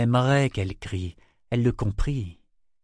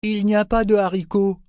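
high, loud protest; indignant exclamation; exclaiming; 11 semitones higher, 6 dB louder than usual. A narrator's reading. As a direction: burst out with it, pitched right up. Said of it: "s'exclama-t-il".

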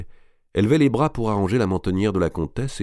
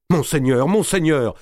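stammering; 8 semitones higher, 4 dB louder than usual. Acted. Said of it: "balbutia Bouville".

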